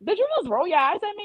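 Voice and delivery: mother catching her daughter's eye roll, incredulous; indignant mom. Retold or imitated. Mom confronts daughter rolling eyes.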